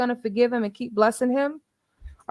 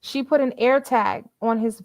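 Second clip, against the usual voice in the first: ordinary talk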